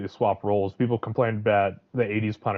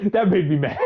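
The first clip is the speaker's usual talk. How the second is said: higher voice breaking into laughter; laughing; 7 semitones higher, 4 dB louder than usual. Real, not acted. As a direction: laugh through the words after venting. Laughs at own outburst.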